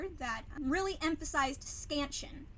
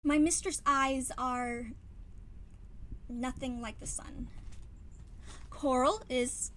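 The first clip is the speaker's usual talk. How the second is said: slow, weighty reading of the sonnet; dramatic slow Shakespeare recital; slower than usual. Shown as a demonstration. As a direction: perform the verse slowly, savouring each line. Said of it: "This is how I kind of performed the monologue".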